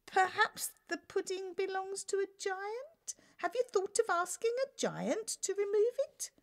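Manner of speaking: timid. Acted. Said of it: "she stuttered".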